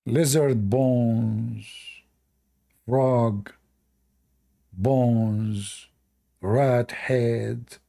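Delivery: low, drawn-out list of potion ingredients; slow incantation. Acted. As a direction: intone each item slowly, with long pauses.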